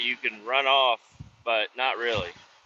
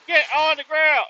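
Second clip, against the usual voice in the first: much higher, louder imitated order; mock command shout; 13 semitones higher, 5 dB louder than usual. Worker quoting the guard's order to him, mock shout. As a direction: bark it like the guard did.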